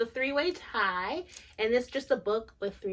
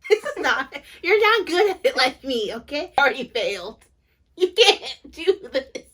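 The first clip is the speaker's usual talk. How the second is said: louder, higher teasing rebuke; playful scolding; 4 semitones higher, 9 dB louder than usual. Real, not acted. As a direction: scold her jokingly. Playful bickering on camera.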